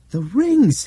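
high, loud cry of discovery; excited shout. Acted. Albert suddenly understands what is missing, excited shout. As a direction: call it out as it dawns on you. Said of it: "he shouted".

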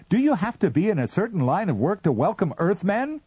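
high, loud pleading; plaintive. A narrator's reading. Captain pleads with the Martian, high. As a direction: plead in exasperation. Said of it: "bleated the captain".

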